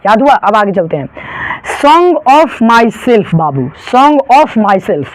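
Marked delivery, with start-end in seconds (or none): 1.8-5.1 Song of Myself drawn out very slowly; slow title reading. Shown as a demonstration. Teacher slowly reading the poem title to students. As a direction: stretch each word of the title. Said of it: "इसके लेखक कौन हो गए".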